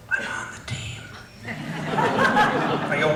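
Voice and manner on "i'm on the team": secret unvoiced whisper. Hollywood colleague confiding to speaker, whispered. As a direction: breathe it like a secret. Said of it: "Why are you whispering?".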